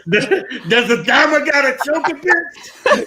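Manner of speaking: high-pitched cackling jibe; squealed through laughter; laughing mock taunt. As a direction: crack up while shouting the joke. Joking outburst amid shared laughter.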